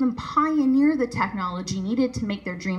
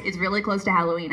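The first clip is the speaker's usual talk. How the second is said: ordinary talk, nothing marked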